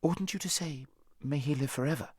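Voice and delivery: high-voiced, shocked question; rather shocked. A narrator's reading. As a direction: query it high, mildly scandalised. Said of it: "in rather a shocked voice".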